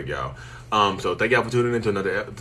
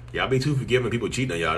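usual speaking voice

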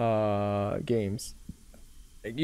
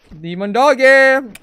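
loud, slow taunting call; mocking call; 11 semitones higher, 14 dB louder, slower than usual. Real, not acted. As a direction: taunt the monster singsong. Calls out to the monster.

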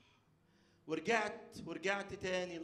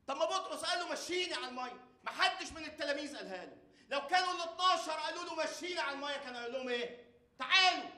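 preaching at full force; 14 semitones higher, 11 dB louder than usual. Acted.

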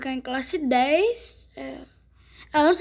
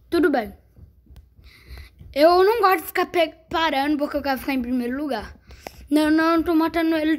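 ordinary delivery

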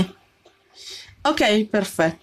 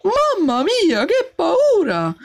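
higher, slow, shaken mamma mia; real fright; 7 semitones higher, slower than usual. Real, not acted. Gamer after a near fall, slow frightened sigh. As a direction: let the fear stretch the words. Scary moment in the game.

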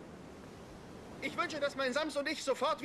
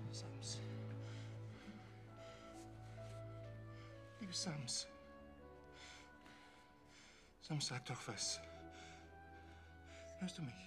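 soft, lower, desperate pleading; tender pleading; 3 semitones lower, 11 dB quieter, slower than usual. Acted. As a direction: beg softly over a sick child. Father pleads over sick Sams.

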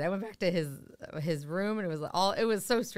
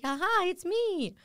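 slow, much higher "aha, it's me" reveal; smug reveal; 10 semitones higher, slower than usual. Retold or imitated. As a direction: draw it out like a proud surprise. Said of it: "he was like".

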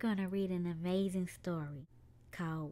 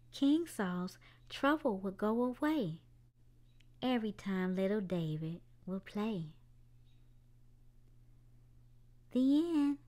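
gentle storybook narration for little ones; children's story time read-aloud. A narrator's reading. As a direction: tell it like a bedtime story. Reads a picture book aloud.

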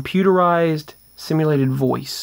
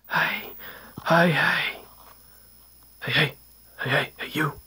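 soft voiceless calls coming closer; whispered calling; 6 dB quieter than usual. Retold or imitated. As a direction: whisper it like something in the dark yard. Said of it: "a voice that was whispering".

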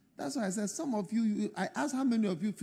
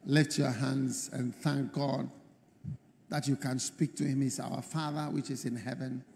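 leading prayer; 5 semitones lower, 6 dB quieter than usual. Real, not acted. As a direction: usher the congregation gently into prayer. Leads congregation into prayer.

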